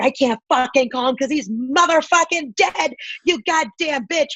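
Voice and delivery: angry outburst. Retold or imitated. Grieving daughter lashing out at Costco cashier, angry retold outburst.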